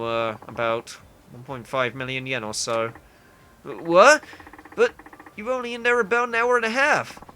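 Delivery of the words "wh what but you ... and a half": loud, high-pitched disbelief; stammer, then burst out incredulous; shocked outburst. Acted. Hearing the friend's club bill, shocked outburst.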